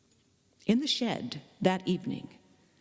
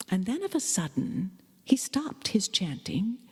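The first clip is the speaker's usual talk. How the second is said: hushed. A narrator's reading. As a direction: drop to a tense whisper. Sudden hush in narration.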